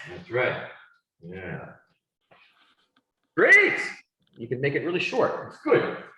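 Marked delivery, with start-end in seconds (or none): 3.4-3.8 high, bright cry of great; big enthusiastic great; excited praise. Real, not acted. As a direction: cheer the student on. Praising student answer.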